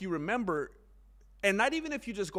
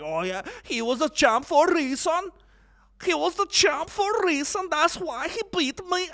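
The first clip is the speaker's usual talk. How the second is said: high, loud attempt at a Liverpool accent; a hint of Paddy Pimblett; 11 semitones higher, 7 dB louder than usual. Paddy Pimblett talking up Gaethje after losing, Liverpool accent. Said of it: "he was like".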